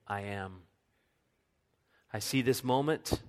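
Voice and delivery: soft, low, gentle divine comfort; tender reassurance. Acted. God speaking to a frightened believer, soft and tender. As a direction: hush them like a frightened child.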